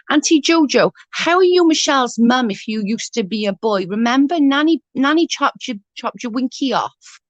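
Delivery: put-on high, innocent little boy asking; child's voice. Aunt voicing her small nephew asking innocently, high child voice. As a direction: pitch way up like a curious toddler.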